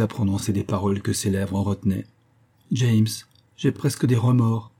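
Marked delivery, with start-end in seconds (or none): none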